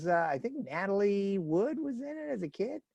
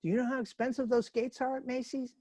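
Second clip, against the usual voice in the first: mad mom; 7 semitones higher, 6 dB quieter than usual. Acted. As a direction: lightly act out an annoyed mother. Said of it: "And the mom's mad".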